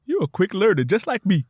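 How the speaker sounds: encouraging. A narrator's reading. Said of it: "offered words of encouragement".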